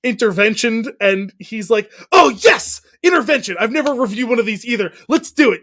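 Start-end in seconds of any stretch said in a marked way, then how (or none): 2.1-5.6 high, exclaiming mock enthusiasm; retold over-eager reviewer, pitched up; gleeful excitement. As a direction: burst out with delighted, hammed-up eagerness. Acted eager delight at getting an intervention.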